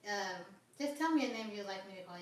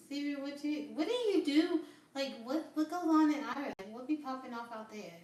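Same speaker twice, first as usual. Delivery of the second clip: excited call-out; 6 semitones higher, 9 dB louder than usual. Real, not acted. Excitedly calling out to a viewer.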